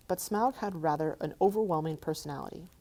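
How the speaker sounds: usual speaking voice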